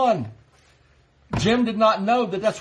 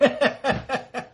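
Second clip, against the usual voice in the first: a short real chuckle, higher; real laugh; 6 semitones higher than usual. Real, not acted. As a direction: laugh at your own memory. Laughs at own story.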